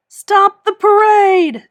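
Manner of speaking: very loud high slow yell; shouted. A narrator's reading. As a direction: halt everything with a cry. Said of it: "shouted SpongeBob".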